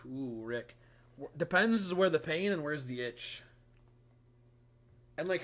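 ordinary talk

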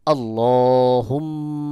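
slow আল্লাহুম্মা, long nasal mm before the final a; ghunnah. Shown as a demonstration. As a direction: hold the doubled m in আল্লাহুম্মা as a hum. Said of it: "অবশ্যই গুন্নাহ হবে". (আল্লাহুম্মা-তে গুন্নাহ করে)

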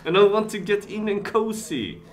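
high, loud playful voice for the ball; voicing the ball. Streamer voices the winning ball, high, loud and giddy. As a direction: speak as the ball, giddy and pleased. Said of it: "He said".

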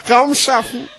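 high, emphatic, exclaimed phrases; grand slam declaiming. Acted. Slam poet exalting self-expression, grandly exclaimed. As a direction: proclaim each phrase like a great triumph.

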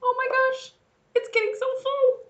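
teacher marvelling at the crowded toy boat; mock amazement. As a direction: play up the surprise for the kids. Boat overfilling with toy animals.

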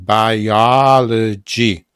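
"Biology" very slow and loud, second syllable held; stretched stress demo. Shown as a demonstration. Teacher showing the accented syllable of biology, slow loud demonstration. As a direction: draw "Biology" out loudly syllable by syllable. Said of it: "przed akcentem trzeba dać".

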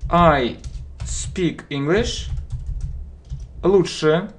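ordinary talk, nothing marked

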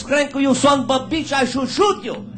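cheated buyer bellows at the seller; angry yelling. Acted. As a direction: berate the horse seller at full volume.

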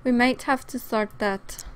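usual speaking voice

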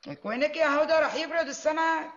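high, loud call across the house. Acted. Mother-in-law calling Huda to lunch before the fish cools, loud call.

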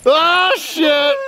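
very high-pitched yelled curse; high outcry. Real, not acted. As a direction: cry out in a high squeal. High cry of alarm.

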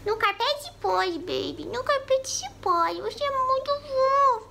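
high, slow cooing pet voice; high sweet pet talk. Real, not acted. Cooing at cat.

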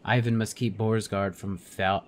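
ordinary delivery

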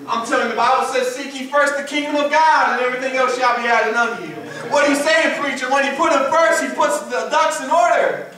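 high, loud, sustained sermon cry; full-force preaching. Acted. Preacher testifying to the church of God's peace in the valley, full-force preaching. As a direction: preach it loud with driving intensity.

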